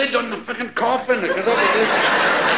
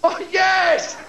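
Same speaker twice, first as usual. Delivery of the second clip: mock ecstatic shout; 9 semitones higher, slower than usual. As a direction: shout it like two boys overcome. Both boys climax, shouted.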